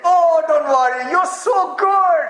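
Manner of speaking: loud, slow, laughing comfort as God; the Father reassuring. Acted. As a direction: boom out the comfort slowly with a laugh. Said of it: "Jesus said".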